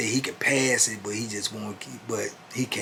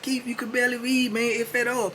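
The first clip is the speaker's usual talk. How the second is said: raised pitch, exasperated; arguing; 13 semitones higher than usual. Retold or imitated. Cellmate telling Keith he can't read, exasperated. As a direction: tell him straight, fed up.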